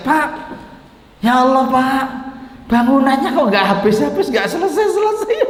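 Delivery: high-pitched mock wailing complaint; mock crying. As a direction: whine it out as a hammed-up sob. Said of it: "sampai niso kok nangis?". (pura-pura menangis dengan suara tinggi)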